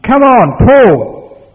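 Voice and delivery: loud, high-pitched yell; shouting. A narrator's reading. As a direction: shout a command at a stubborn animal. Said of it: "he shouted at his ox".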